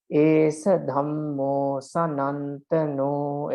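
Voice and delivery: very slow, each syllable held; drawn-out, evenly spaced syllables; syllable-by-syllable counting. Shown as a demonstration. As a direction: count out the verse line syllable by syllable. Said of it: "You can count them".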